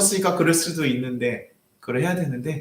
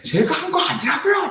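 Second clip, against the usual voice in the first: high, pitched-up protest; retold outburst. Pastor retelling his outburst at the old man, high protest. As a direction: blurt it out way up high. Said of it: "팍 터진 거예요".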